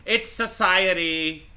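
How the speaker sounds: high, loud, stretched-out mimicry; mock her stock excuse, dragging it out; mocking imitation. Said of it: "she's gonna immediately go to".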